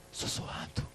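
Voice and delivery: breathy whisper of the word sussurrando. Shown as a demonstration. Preacher demonstrating [name]'s whisper to the church, whispered. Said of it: "cantava sussurrando".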